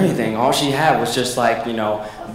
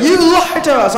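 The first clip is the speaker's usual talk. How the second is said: mock yell; 13 semitones higher, 3 dB louder than usual. Retold accusation at villain.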